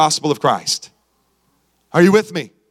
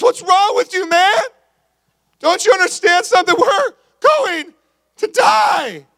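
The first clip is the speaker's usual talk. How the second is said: panicked shout; 10 semitones higher than usual. Acted. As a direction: yell it like a castaway sure of doom. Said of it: "Other guy looks at us".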